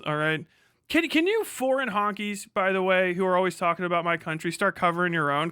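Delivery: ordinary delivery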